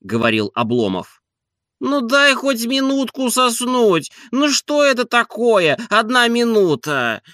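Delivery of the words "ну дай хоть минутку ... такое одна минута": very high, slow wheedling; drawn-out high sleepy whine; whining plea. A narrator's reading. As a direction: beg for a little more sleep. Master begs for more sleep.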